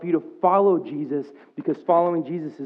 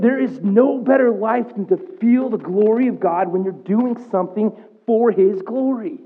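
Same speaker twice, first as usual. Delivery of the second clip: higher, louder, impassioned preaching; fervent preaching; 6 semitones higher, 5 dB louder than usual. Real, not acted. Pastor telling the church about feeling God's glory, fervent preaching. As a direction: preach it with swelling passion.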